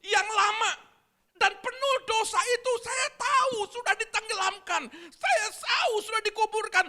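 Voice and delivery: loud, slow preaching testimony. Acted. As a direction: proclaim it with force. Preacher testimony at full force. (khotbah lantang dan lambat)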